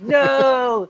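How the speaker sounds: mock dying cry. Jokes about being killed by dogs.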